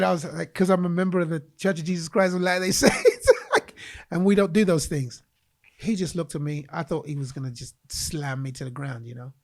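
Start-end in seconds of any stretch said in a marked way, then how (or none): 0.5-5.2 laughing while explaining. Real, not acted. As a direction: chuckle through the words. Laughing at own excuse.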